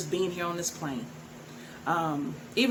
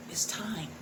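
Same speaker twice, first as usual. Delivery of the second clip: hushed it's time; whispered emphasis; 5 dB quieter than usual. Real, not acted. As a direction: drop to a whisper for weight. Solemn emphasis.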